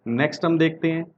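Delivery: ordinary talk, nothing marked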